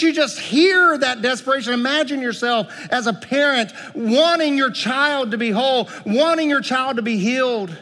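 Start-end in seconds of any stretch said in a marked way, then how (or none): none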